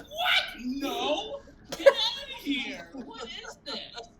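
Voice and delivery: high, loud mock shock; hammed-up yelp, stretching out the later words; horrified outcry. Son changing dad's diaper, loudly acting appalled.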